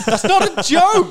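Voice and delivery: high, loud, slow outburst through a laugh; exasperated shout. Pretend. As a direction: yell it, laughing in disbelief. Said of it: "And it's like".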